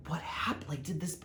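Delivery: hushed, breathy inner panic; whispered thought. Retold or imitated. Spying through her peephole, she whispers a panicked question to herself. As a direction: whisper it to yourself, frozen at the peephole.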